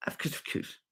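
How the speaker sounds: very soft, barely voiced cartoon imitation; Jimmy encouraging people. Cartoon comic encouraging his audience, very soft imitation. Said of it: "He's like that".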